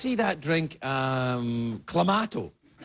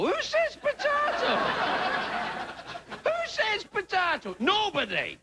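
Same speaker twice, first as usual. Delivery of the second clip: much higher, slow mock disbelief; hammed-up high outrage at "potato"; slower than usual. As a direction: squeal "Who says potato?" in fake shock.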